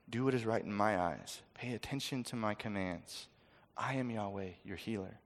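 soft, low, gentle promise; tender voice of God. Acted. God speaking softly to his people, low and tender.

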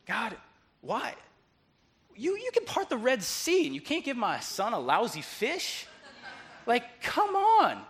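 acted-out frustration, climbing high; exasperated at God. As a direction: protest to heaven in rising disbelief. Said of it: "he's getting angry at God".

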